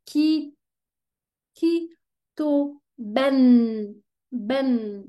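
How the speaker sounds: kitaben broken into ki, ki, to, ben as a model; syllable by syllable. Shown as a demonstration. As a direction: chop the word into syllables. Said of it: "On dit".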